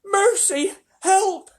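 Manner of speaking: very high, loud cries for help; terrified cries. A narrator's reading. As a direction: scream for help in panic. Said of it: "cried the ladybird".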